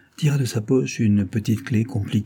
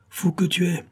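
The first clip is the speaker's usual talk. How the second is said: exclaimed; 9 semitones higher than usual. A narrator's reading. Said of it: "s'écria-t-il".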